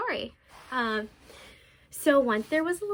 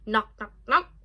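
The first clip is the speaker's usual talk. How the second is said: knocking sound; 4 semitones lower, 4 dB louder than usual. Acted. Wolf knocks on the door.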